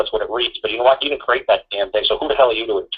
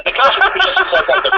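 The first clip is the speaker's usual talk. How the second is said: much higher, words dissolving into real laughter; laughing through words. Real, not acted. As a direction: try to repeat the line but can't stop laughing. Cracking up at own joke.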